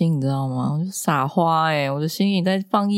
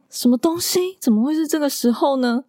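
shocked disbelief; 10 semitones higher than usual. Retold or imitated. Shocked at teacher's phone call.

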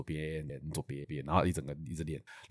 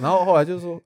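ordinary talk, nothing marked

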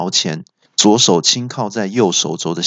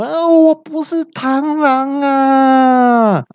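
very high, drawn-out wailing yell; villain's outcry; 3 dB louder, much slower than usual. A narrator's reading. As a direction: wail it out high and long in protest. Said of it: "那恶怪就大叫说". (高声拖长大叫)

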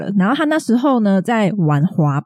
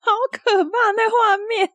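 horrified; 14 semitones higher than usual. Real, not acted. Reacting to bloody knees.

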